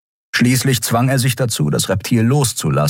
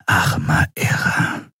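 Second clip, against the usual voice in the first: slow, hushed dismay; stunned whisper; slower than usual. A narrator's reading. Skria looking at dead captive, stunned whisper. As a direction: whisper it, aghast. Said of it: "flüsterte Shiar entgeistert".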